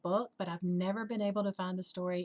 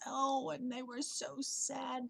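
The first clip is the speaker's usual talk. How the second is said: higher, softer, drawn-out pity; gently sad narration; 5 semitones higher, 5 dB quieter, slower than usual. A narrator's reading. Storyteller pitying the rejected butterflies, mildly sad. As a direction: sigh it out slowly with a touch of sorrow.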